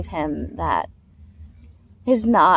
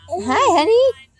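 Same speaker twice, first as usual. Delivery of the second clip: high, bright sing-song mom voice, much higher; mother cooing excitedly to her son Miles; sweet talk to her son; 7 semitones higher than usual. Real, not acted.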